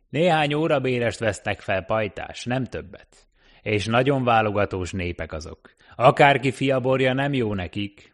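a wary taxi driver warning a stranger off; cabbie voice. Acted. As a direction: talkative cab driver warning off a newcomer.

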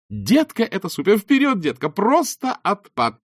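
high, loud, gushing excitement; breathless host hype; gushing gasp. A narrator's reading. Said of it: "ахнула Килли".

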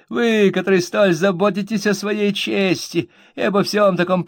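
high, rough and whiny; rude whining voice. A narrator's reading. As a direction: sneer it out while whining. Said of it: "обратился ко мне грубым и вместе с тем каким-то хнычущим тоном".

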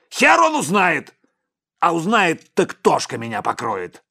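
high, loud defiant yell, dropping in pitch; shouting. A narrator's reading. Boss defies his bodyguard's warning, yelling then coming down. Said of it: "закричал Сипякин".

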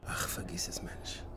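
hushed aside, barely voiced; whisper. Blaschek whispering an aside while the secretary waits. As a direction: mutter it under your breath, unvoiced.

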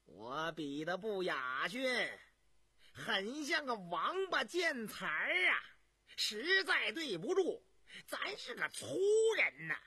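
mocking apology. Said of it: "一笑又说道".

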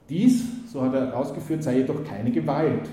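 normal talking voice

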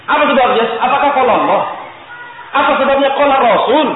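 loud, high demanding questions; rhetorical challenge. Real, not acted. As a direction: challenge the congregation.

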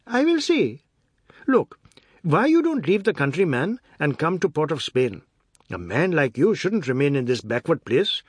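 high, loud, pushy brash warden; brash warden urging. Acted. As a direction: press him to move to the city. Ramchand urging Biswas to come.

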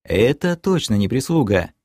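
plain reading voice

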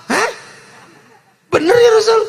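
high, loud cry of disbelief; loud astonished. Acted. Umar to the Prophet, stunned, asks again, high and loud. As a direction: Umar shouts in shock at the answer.

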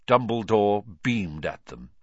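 ordinary delivery

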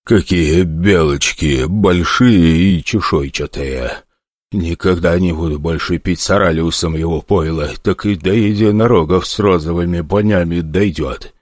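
a lightly voiced grandfather who thinks he's lost his mind; raving grandfather. Drunk old man's raving thoughts.

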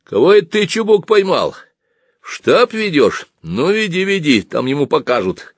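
high-pitched inquisitive questioning; curious. Acted. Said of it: "сразу переменив тон, спросил с любопытством". (с любопытством, высоким голосом)

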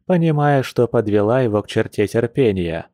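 ordinary delivery, nothing marked

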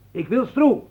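high, loud demand; shouted insistence, pitched well up; insistent shout. Acted. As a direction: yell it as an urgent demand. Meijer demands to see Stroe.

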